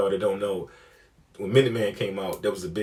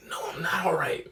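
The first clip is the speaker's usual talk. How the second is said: retold stunned whisper; breath-only, rattled reply; shaken whisper; 7 semitones higher, 3 dB quieter than usual. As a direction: whisper it like you're badly rattled. Shaken answer to concerned friend.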